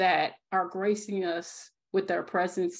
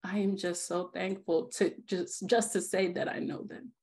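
ordinary delivery

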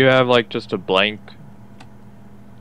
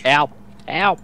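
mocking whine; 3 semitones higher than usual. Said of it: "mocking the calls for help".

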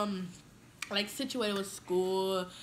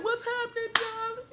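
mocking boys; 14 semitones higher than usual. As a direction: overdo a bro's swaggering hello. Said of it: "how y'all boys be like".

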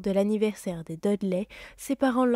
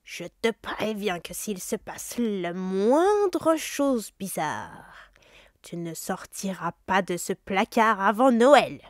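very slow, drawn-out threat growing louder at the end; menacing uncle; 4 dB louder, slower than usual. Acted. Uncle Vernon warning Harry before the zoo, slow and menacing. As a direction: spell out the threat word by word.

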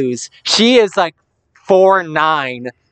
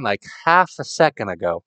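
ordinary delivery

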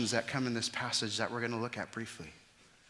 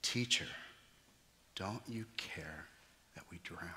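broken whisper; 4 semitones lower, 7 dB quieter than usual. Real, not acted. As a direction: whisper it like you're going under. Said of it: "I was drowning".

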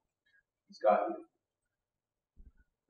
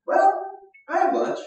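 put-on much higher, small child's offer; little kid voice; 6 dB louder than usual. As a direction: pipe up like a shy little boy. Child voiced in Bible story.

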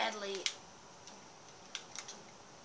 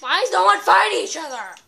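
loud, higher exasperated shout; impatient yelling; 7 semitones higher, 15 dB louder than usual. Real, not acted. Bored spectator shouting at players to fight. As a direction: complain loudly at the idle players.

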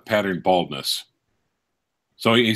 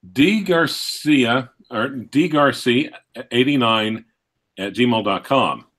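spaced out with pauses between parts; slow, careful; slower than usual. Real, not acted. Reader repeating an email address for viewers, slow and careful. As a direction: read the address out piece by piece.

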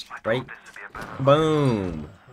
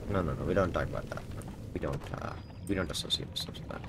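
hushed denial; 4 semitones lower, 10 dB quieter, faster than usual. Real, not acted. Mock denial fading.

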